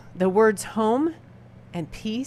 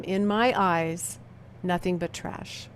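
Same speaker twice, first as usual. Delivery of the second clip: usual speaking voice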